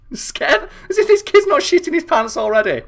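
high, loud incredulous scoff through laughter; mock the line while still laughing; laughing mockery. Real, not acted. Mocking cutscene line, laughing.

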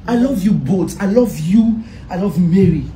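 ordinary talk

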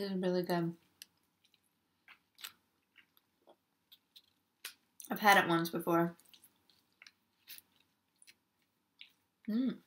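eating between words; talking while chewing. Real, not acted. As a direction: mouth partly full of food. Seen 3: took a bite at -7, lips closed, jaw working while eating.

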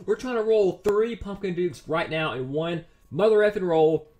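ordinary talk, nothing marked

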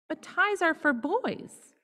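put-on high, slow childish protest; whiny child. A narrator's reading. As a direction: plead like a little girl.

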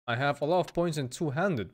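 ordinary talk, nothing marked

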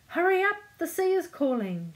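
high, loud rallying shout. A narrator's reading. Pirate mother from her wheelbarrow to son, shouting. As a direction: shout to hurry him along. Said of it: "shouted the pirate mother".

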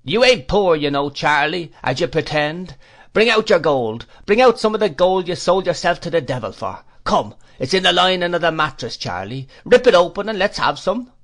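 put-on boys calling out, high and loud; high-pitched street boys' heckling; jeering shout. A narrator's reading. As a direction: taunt the old man at a shout. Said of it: "shouting that legend".

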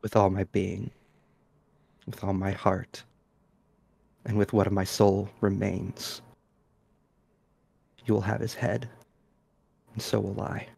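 quiet solemn vow. Acted. Witcher swears oath, low and quiet.

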